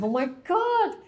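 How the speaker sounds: high, drawn-out exasperation; mock dismay. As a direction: play up the exasperated realization. Acted dismay at hearing it again.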